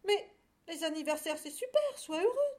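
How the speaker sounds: very high, loud cheering-up; excited encouraging. A narrator's reading. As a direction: urge a tearful friend to be happy. Cow cheers up sobbing lamb. (encouragement enjoué, voix aiguë)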